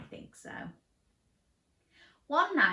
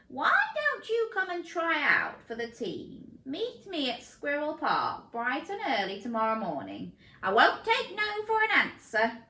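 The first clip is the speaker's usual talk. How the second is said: coach chameleon voice; 7 semitones higher, 4 dB louder than usual. Acted. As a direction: play the enthusiastic coach. Coach character voice.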